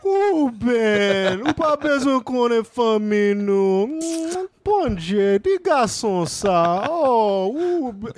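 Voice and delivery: high wailing Haitian Creole guilt trip; theatrical Creole lament. Retold or imitated. Haitian uncle wailing in Creole at his nephew. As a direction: wail it in Creole like a grieving aunt. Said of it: "but I'll do it in".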